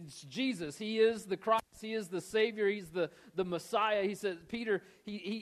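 ordinary delivery

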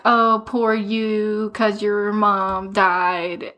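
very slow, drawn-out mocking pity; mock sympathy. Girl taunted in a contest of tragedies, drawling mock sympathy. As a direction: stretch each word in fake sympathy.

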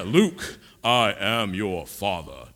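low, very slow, heavy villain line; Darth Vader. Shown as a demonstration. Preacher acting the Star Wars reveal, deep and slow. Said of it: "that wasn't deep enough".

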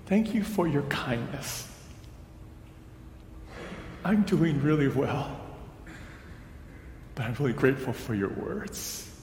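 softly choked-out thanks; halting and soft, retelling tearful gratitude. Retold or imitated. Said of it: "I eventually choked out".

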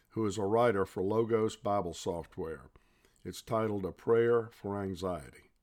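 ordinary talk

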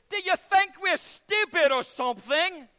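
high, loud, slow mocking taunt; scornful kid calling out; cheeky English boy. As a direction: play the know-it-all schoolboy. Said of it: "one of the boys ... said".

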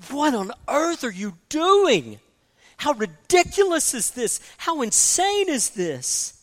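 exasperated disbelief from the pulpit; incredulous rebuke. Acted. God voiced rebuking idol makers.

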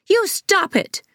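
high shrill shriek of protest; high-pitched childish shriek. A narrator's reading. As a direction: shriek it like a furious kid. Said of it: "Devon shrieked".

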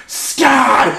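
high laughing call of a name. Retold or imitated. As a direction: shout the name through a laugh. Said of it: "He goes".

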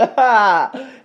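loud, high laugh imitating a friend; cheerful laugh. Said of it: "She was so cheerful".

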